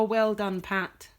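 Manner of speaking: ordinary delivery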